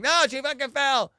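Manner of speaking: high, exasperated protest; frustrated. Retold or imitated. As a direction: insist in mock exasperation, pitch up. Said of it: "I'm getting frustrated".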